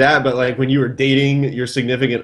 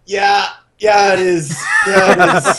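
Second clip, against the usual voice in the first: high, laughing yeah, it is; sheepish laughing admission; laughing confession; 8 semitones higher, slower than usual. Retold or imitated. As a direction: own up through laughter. Admitting booger, laughing.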